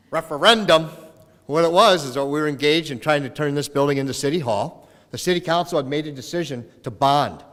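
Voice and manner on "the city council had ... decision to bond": ordinary talk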